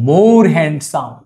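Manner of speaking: very slow, stretched out for students to copy; slow dictation. Shown as a demonstration. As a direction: draw out each word like a teacher dictating. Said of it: "তখন বলতে হবে". (খুব ধীরে উচ্চারণ)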